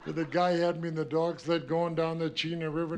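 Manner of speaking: ordinary delivery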